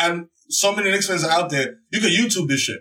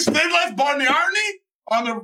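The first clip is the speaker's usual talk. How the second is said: ordinary delivery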